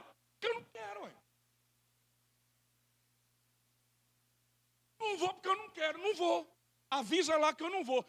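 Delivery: high, fast and curt; mock rude guest saying he simply doesn't want to; blunt refusal. Shown as a demonstration. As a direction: refuse flatly, no apologies, just won't. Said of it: "Não, a gente não fala assim".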